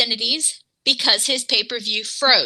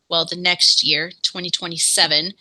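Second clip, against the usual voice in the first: ordinary talk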